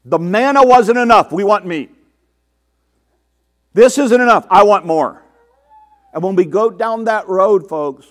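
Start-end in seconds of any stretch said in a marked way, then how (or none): 0.0-5.2 mock griping. Preacher voices discontented Israelites and himself, mock griping. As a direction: imitate an ungrateful complainer, punching it out. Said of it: "when I start griping and complaining".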